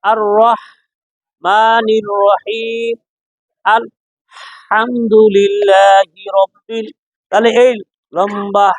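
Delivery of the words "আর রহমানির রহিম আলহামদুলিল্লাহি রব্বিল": very slow, drawn-out recitation; Quran recitation example. Shown as a demonstration. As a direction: recite it slowly, stretching the vowels. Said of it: "যেমন উদাহরণ". (ধীরে টেনে তেলাওয়াত)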